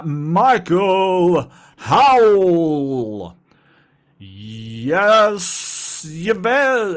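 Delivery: fighting game announcer. Acted. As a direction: call the name like a ring announcer. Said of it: "announcer voice".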